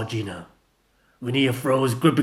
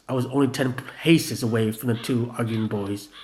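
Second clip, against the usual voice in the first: ordinary delivery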